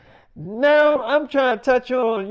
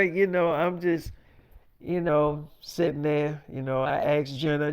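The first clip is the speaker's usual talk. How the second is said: normal talking voice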